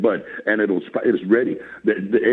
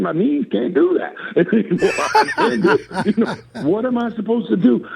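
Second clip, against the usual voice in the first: laughing; 6 semitones higher than usual. Real, not acted. Laughs at lotus position.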